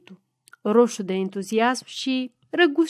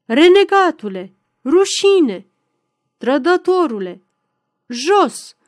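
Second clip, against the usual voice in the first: loud, raised volley of jeers; yelled abuse; 5 semitones higher, 7 dB louder than usual. A narrator's reading. Dozens of voices at the supposed traitor, yelled abuse. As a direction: hurl each word as an accusation. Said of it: "țipau zeci de voci".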